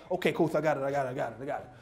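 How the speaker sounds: put-on soft, low, lisped kid repetition; toothless little kid. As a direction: mumble it eagerly like a lisping child. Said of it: "so he sounded like this".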